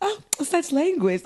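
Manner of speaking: high, prim mock shock; mock prim lady. [name] mock scandalised at language, high-pitched. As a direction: gasp it like a proper lady.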